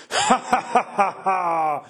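high, theatrical villain's laugh; bursting into laughter. A narrator's reading. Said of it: "éclatant de rire".

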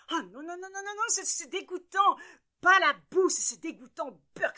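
high frantic squealing no no no; panicked disgust. A narrator's reading. Parents fall into mud, panicked high disgust. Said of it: "s'écrient en chœur monsieur et madame Propre affolés".

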